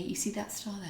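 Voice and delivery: soft, half-whispered granddad's words; hushed retold quote. Granddaughter quoting her late granddad, hushed and tender. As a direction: recall his words in a near whisper.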